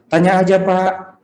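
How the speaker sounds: high, drawn-out sing-song call; lilting mock sales patter; sales promoter girl. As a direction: imitate a promoter calling out slowly to passers-by. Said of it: "lalu ada mbak-mbak suka tanya".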